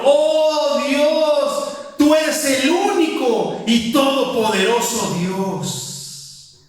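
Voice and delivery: high, slow, lifted praise voice; fervent prayer. Retold or imitated. The preacher translates the prayer, in fervent praise. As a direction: proclaim God's greatness in worship. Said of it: "A un entendedor".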